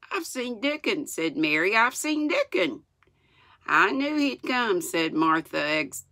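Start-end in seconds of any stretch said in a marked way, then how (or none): 0.1-1.1 high excited news. A narrator's reading. Mary tells Martha her news, excited. 1.8-2.8 very high, excited voice. A narrator's reading. Mary repeats her news to Martha, excited. 3.7-4.8 mildly exultant, raised pitch; a touch of high-pitched triumph. A narrator's reading. Martha hears Mary's news, mildly exultant. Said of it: "exultantly, with exultation".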